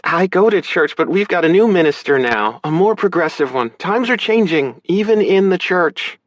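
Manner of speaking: high, emphatic sister voice; high-pitched, sharper telling-off; sharp big sister. A narrator's reading. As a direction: put him straight like a big sister. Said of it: "sharper now, big sister tone back".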